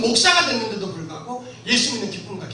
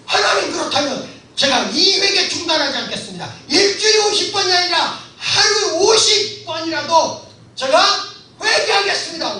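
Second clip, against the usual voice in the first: loud vow; 6 semitones higher, 5 dB louder than usual. Retold or imitated. Vowing to God, shouting.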